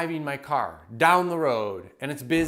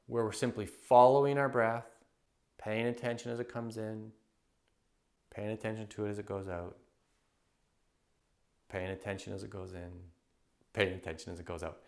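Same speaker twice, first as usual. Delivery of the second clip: guided meditation; 5 semitones lower, 6 dB quieter than usual. Acted. Coach guides listeners to follow their breath, softly. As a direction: lead the breath gently, fading down.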